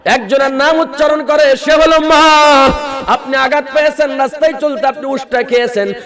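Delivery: high, loud, chanted appeal; full-force preaching. Acted. Preacher pleading with the young men, high and loud. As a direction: cry out to the young men in a sung pitch. Chanted praise over music.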